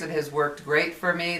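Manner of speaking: usual speaking voice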